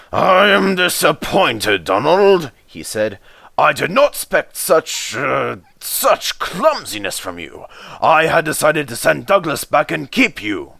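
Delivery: cross fat controller. Acted. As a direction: reprimand the engine gravely. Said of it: "was cross too".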